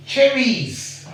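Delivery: "cherries" sung out high after a long pause; drawn-out reveal. Shown as a demonstration. Teacher revealing the plural to her pupil. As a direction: hold back, then lift "cherries" up high. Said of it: "cherry becomes, you know".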